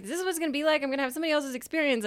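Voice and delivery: high, rushing mock panic; freaking out. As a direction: act out a worked-up inner freak-out. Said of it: "freaking out".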